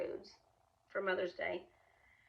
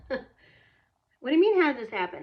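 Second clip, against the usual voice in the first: indignant comeback; 5 semitones higher, 12 dB louder than usual. Retold or imitated. Indignant comeback to mother-in-law.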